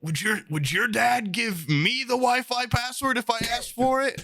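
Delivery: imitating himself snapping back at the dad; mock angry rant. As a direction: rant like an offended teen. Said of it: "he went off and was like".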